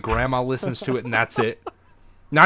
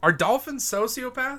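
pitched-up dramatic question; high mock question; 10 semitones higher than usual. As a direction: ask it high like a game host.

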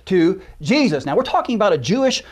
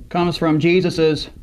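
ordinary talk, nothing marked

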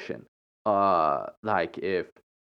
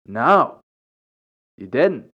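louder, flat-out blunt retort; matter of fact; 3 semitones higher, 6 dB louder, slower than usual. Retold or imitated. She answers the churchgoer's hint, blunt and matter of fact. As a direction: shut the hint down, plainly. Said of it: "so matter of fact about it".

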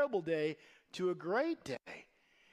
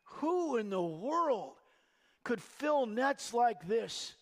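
slow, awestruck disbelief; awed wonder; slower than usual. Retold or imitated. Peter stunned by the miraculous catch, slow and awestruck. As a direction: linger on each word in amazement. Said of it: "He's like".

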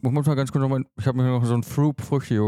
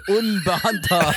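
higher, laughing repeat of the word; laughing mockery; 6 semitones higher than usual. Real, not acted. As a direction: laugh while mocking the slip. Mocking friend's slip, laughing.

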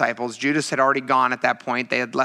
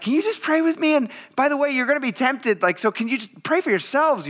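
high-pitched appeal, easing down; pleading; 11 semitones higher than usual. Acted. Jesus waking his disciples to pray, high exasperated plea. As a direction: plead lightly with your sleepy friends, pitched up.

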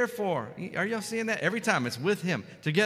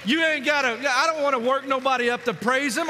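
loud, high fervent exhortation; preaching at full force; 7 semitones higher, 7 dB louder than usual. Real, not acted. Preacher urges congregation to come ready to praise, fervent. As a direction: urge them on with fire.

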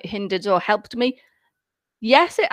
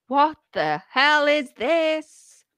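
1 slow exasperated query; 4 semitones higher, much slower than usual. Retold or imitated. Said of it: "you're like".

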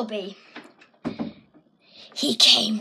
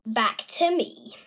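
normal talking voice

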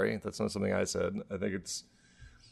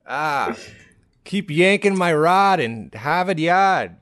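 slow, high, loud mock Boston accent; hammed-up South Boston bedroom voice; 6 semitones higher, 4 dB louder, slower than usual. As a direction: stretch it out like a Boston lover. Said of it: "doing the South Boston accent".

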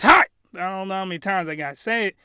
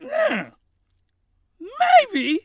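put-on girly voice. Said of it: "she's like".

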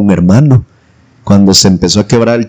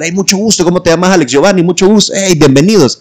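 higher-pitched mock introductions; imitated friendly greeters; 7 semitones higher than usual. Campers greeting the brothers by name, imitated friendly hellos. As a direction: play the eager welcomers in a higher voice.

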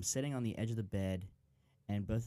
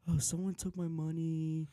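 higher-pitched mock-worried parent voice; parents faking alarm; 6 semitones higher than usual. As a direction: act out a put-on announcement, pitched up. Retelling parents' staged talk.

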